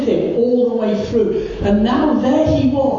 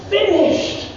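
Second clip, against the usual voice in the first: high, ringing cry of a single word; shouted cry; 9 semitones higher than usual. Acted. Preacher voicing Jesus' last cry from the cross, high and ringing. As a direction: cry it out to all creation. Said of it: "He shouted".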